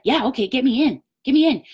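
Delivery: high-pitched eager yes; excited customer. Acted. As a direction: jump at the offer in a high, keen voice. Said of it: "You need to be excited".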